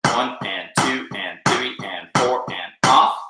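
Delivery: slow, evenly spaced count; rhythmic counting. Shown as a demonstration. Drum teacher counts a bar of bucks, slow rhythmic count. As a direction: count the bar squarely on the beat.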